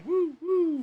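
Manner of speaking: high, hammy whoop; excited whoop. Pretend. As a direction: cheer it like a game show. Cheer after the ad bit.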